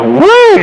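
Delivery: sudden high falsetto whoop. Real, not acted. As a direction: whoop it out high like cheering a buddy on. Cheering on the radio crew.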